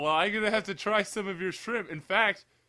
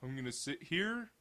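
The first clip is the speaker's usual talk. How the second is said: softly voiced dumb horse; horse's voice; 15 dB quieter than usual. Podcaster voicing what dumb horses think, quiet horse voice. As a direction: lightly play a dim horse, hushed. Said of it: "they're just like".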